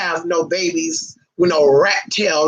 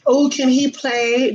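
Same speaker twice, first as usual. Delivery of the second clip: mock dog owner; 5 semitones higher, slower than usual. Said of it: "thinking he gonna get something".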